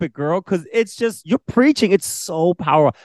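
very high, excited gushing; gushing praise. Real, not acted. Co-host telling her friend how powerful it is, gushing praise. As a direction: pitch it way up, thrilled for her.

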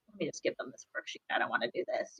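a burned-out teacher muttering quietly to herself; weary under breath. Retold or imitated. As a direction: sigh it out to yourself, worn down. Teacher admits days of giving up.

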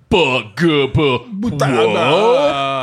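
dumb wordless actor babbling; actor babble. Pretend. Mocking what actors would say without writers, dumb babble. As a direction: mumble like a clueless actor. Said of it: "You know what you'd be saying?".

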